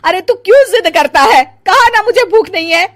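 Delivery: loud, high irritated snapping; angry scolding. Acted. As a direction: snap at him to stop insisting. Mother snaps at son.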